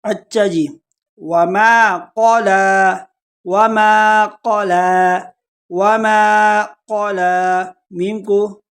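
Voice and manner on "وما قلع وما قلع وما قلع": وما قلع very slowly, ما stretched one alif; slow recitation. Shown as a demonstration. Teacher demonstrating the stretch, slow recitation. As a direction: draw out ما in وما قلع, ق heavy. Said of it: "ایک الف کے برابر اس کو بھی کھینچیں گے".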